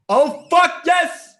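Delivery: high, loud climactic yell. Acted. Student in calculus class overcome mid-story, yelling. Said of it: "while yelling".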